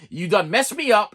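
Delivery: normal talking voice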